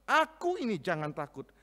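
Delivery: high, loud, commanding reassurance; Jesus calming. Acted. Jesus calls to terrified disciples across water, ringing. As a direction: call out to calm the frightened disciples. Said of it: "Yesus berkata".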